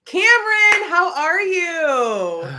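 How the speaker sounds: high, stretched-out hello; excited greeting. Real, not acted. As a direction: call out to a friend, drawn out. Greets a viewer in chat.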